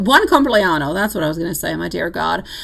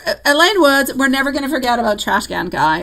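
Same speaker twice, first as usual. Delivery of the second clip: normal talking voice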